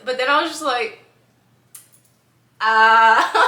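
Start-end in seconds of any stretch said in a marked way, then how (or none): 2.6-3.4 loud, bright ah of getting it; mock aha moment; sudden realization. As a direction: let it dawn out loud.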